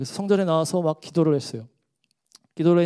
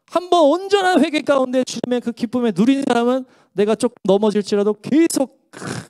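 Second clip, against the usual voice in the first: higher, louder fervent preaching; rising preaching; 8 semitones higher, 5 dB louder than usual. Acted. Preacher urging the congregation, fervent and loud. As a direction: preach it with full force.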